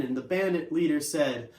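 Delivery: plain reading voice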